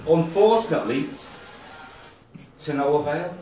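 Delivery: rhythmic spoken-verse delivery; poem recital. Real, not acted. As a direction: recite it line by line, pausing after each phrase. Reads own poem to audience.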